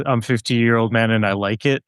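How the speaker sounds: usual speaking voice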